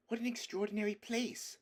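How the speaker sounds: put-on high, bright-eyed girl's voice; a child gasping in wonder; young Lucy amazed at the statues; excited girl crying out. A narrator's reading. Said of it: "cried Lucy".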